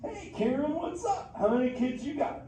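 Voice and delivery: a hyped, easygoing beach dude of a turtle; Crush the sea turtle. Acted. Sea turtle greeting the kids in the theater, stoked surfer-dude voice.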